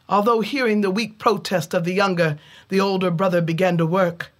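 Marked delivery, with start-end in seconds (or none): none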